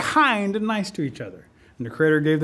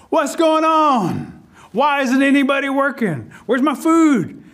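high, drawn-out bossy queen; indignant queen ant; 6 semitones higher, 4 dB louder, slower than usual. A narrator's reading. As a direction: demand answers in a high, raised voice. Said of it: "the queen ant comes out of the anthill and says".

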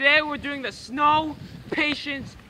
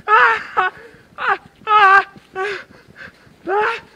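sharp gasps from icy water; cold gasps; 5 semitones higher, 3 dB louder, much slower than usual. Real, not acted. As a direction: gasp loudly from the cold. Freezing, gasping from cold.

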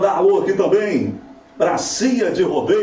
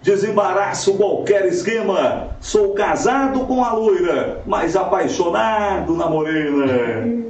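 hyped rodeo announcer; 3 semitones higher, slower than usual. Shown as a demonstration. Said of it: "Vamos lá, assim, ó".

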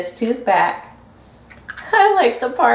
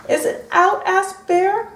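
high hopeful bear voice; 8 semitones higher than usual. Acted. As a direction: ask with hopeful eagerness.

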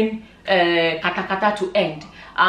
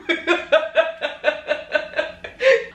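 long helpless laugh after a punchline; real laughter; 6 semitones higher than usual. Real, not acted. Vlogger cracking up at a comment she read, real laughter. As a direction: crack up for a few seconds.

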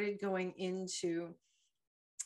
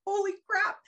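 panicked exclamation; 12 semitones higher, 7 dB louder than usual. Retold or imitated. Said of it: "And I was like".

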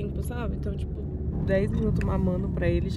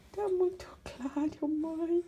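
put-on high and softer, speaking for the baby; 5 semitones higher, 6 dB quieter, slower than usual. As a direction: whine softly as the baby about the light. Fala pelo bebê, soft. (voz de bebê aguda e baixinha, falando pelo nenê)

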